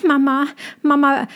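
high little cub calling his mother; tiger cub calling. A narrator's reading. A frightened cub calls to his mother, high and urgent. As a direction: cry out for Mama in a small, high voice. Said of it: "rief Tommy".

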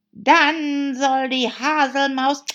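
loud, high, drawn-out shout; high, stretched-out yell; calling out. A narrator's reading. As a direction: shout it out as a demand. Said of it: "riefen beide".

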